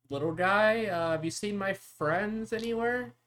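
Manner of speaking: put-on high, loud, tentative question to a kid; calling to a child. Player calls to a child character.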